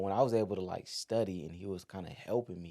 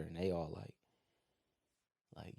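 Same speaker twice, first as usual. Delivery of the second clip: normal talking voice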